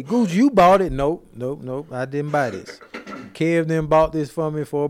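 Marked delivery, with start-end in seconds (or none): 0.0-0.9 higher, louder mock challenge; imitated listener question. Co-host voicing listeners questioning his slides, mock challenge. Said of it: "You gonna say".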